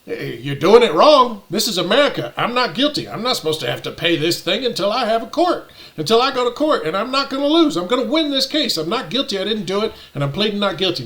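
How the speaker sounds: retold heated objection; indignant arguing. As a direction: argue your innocence, pitch climbing and then easing. Retold argument with the clerk.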